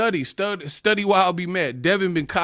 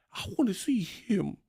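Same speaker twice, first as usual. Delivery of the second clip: whisper; 2 semitones higher, 9 dB quieter than usual. Real, not acted. Whispered aside.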